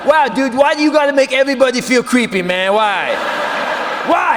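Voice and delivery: loud exasperated scolding; scolding shout. Retold or imitated. Mock outraged scolding of her boyfriend.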